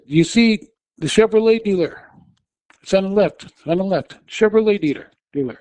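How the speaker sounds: high, loud pleading directions; urgent yelling. Retold or imitated. Passenger yelling at dazed driver to pull in, high urgent shout. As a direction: call out to make him look left.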